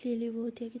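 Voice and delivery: usual speaking voice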